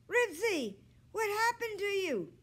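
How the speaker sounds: high, loud worried call; anxious calling. A narrator's reading. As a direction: call after the lost dog in alarm. Boy calling, dog ran away.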